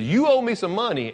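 high, pressing demand for payment; mock demand. Forgiven servant to the man who owes him, demanding. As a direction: act out a creditor calling in a debt.

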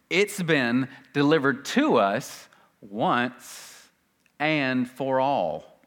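very slow, measured phrases; slow emphasis. Real, not acted. Preacher driving home "once and for all", slow and deliberate. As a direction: weigh out every phrase with pauses. Same point repeated word by word.

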